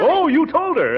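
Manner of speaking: high startled question; surprised. Acted. Uncle startled by Leroy's news, high surprised question.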